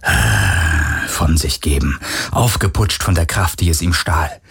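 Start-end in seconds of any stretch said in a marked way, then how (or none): 0.0-1.1 low, drawn-out sigh of satisfaction; satisfied "ah". A narrator's reading. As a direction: let out a slow, contented creature's "ah". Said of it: "er hörte das Wesen ein befriedigtes". (tiefes, befriedigtes Ah)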